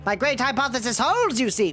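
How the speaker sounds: high, quavering professor voice; very old man. Acted. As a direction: play a proud old scientist, pitched high. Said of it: "He's turning into the old man voice".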